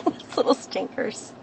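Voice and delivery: breathy, whispered affectionate remark; whispered aside. Real, not acted. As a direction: whisper fondly to yourself. Affectionate aside after laugh.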